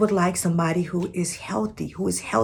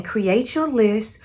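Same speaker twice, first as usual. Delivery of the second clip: normal talking voice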